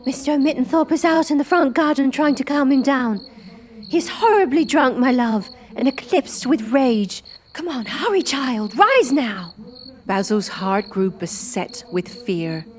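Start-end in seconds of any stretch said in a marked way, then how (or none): 0.0-9.5 put-on high, anxious old woman's voice; frightened old woman. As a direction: hurry the boy along in fear. Frightened woman urging escape.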